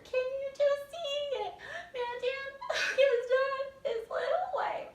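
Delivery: very high, slow, loud despairing whine; hammed-up jealous misery, slowly; high mock-despair with a sob; over-the-top disgust. Shown as a demonstration. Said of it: "So you guys are just over the top".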